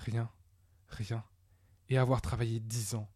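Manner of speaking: understated despair, with long pauses; quietly crushed. Acted. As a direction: let the words fall heavily, one by one. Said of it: "Il s'assit et pleura".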